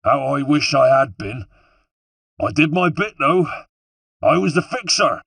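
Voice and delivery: high, loud West Country villain; taunting West Country shout; West Country accent. A narrator's reading. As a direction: call back boastfully in a West Country accent. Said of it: "He had a West Country accent".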